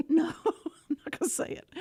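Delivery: laughing through the words; laughing refusal. Retold or imitated.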